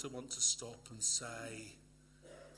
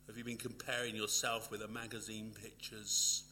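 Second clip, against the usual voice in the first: ordinary talk, nothing marked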